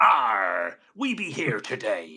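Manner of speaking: slow, drawn-out pirate growl of a greeting; pirate accent. Acted. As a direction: stretch it out like a hammy pirate. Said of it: "do it in a pirate accent".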